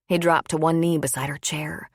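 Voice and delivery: usual speaking voice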